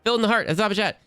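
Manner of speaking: hyped shoutout. Acted. Streamer giving chat a hyped shoutout. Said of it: "Don't do the shouty outies".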